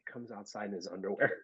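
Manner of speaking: ordinary talk, nothing marked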